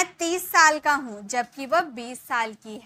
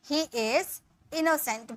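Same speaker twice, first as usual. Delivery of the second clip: slow, clear dictation; slow model sentence; 3 semitones higher, much slower than usual. Shown as a demonstration. Teacher dictating the translation slowly. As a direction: stretch it for students to follow. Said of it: "इसके लिए बोलेंगे".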